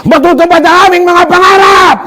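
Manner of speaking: high, sustained rallying cry; full shout. Shown as a demonstration. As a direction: shout it out to lead the crowd. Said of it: "Pag sumigaw ako".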